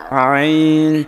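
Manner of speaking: আইন constricted in the throat, drawn out; deep-throat ain. Shown as a demonstration. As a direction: squeeze আইন against the throat. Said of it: "একেবারে গলার সঙ্গে লাগে". (গলায় চেপে টানা আইন)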